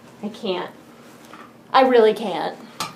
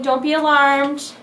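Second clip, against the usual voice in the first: ordinary talk, nothing marked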